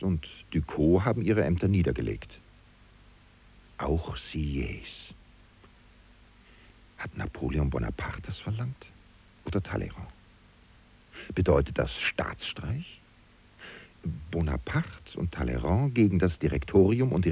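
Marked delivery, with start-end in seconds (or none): 3.8-13.0 hushed, fearful whisper of suspicion; anxious whisper. Acted. Secretary fearfully whispering his coup suspicions to the listener, hushed. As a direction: whisper it as if afraid of being overheard.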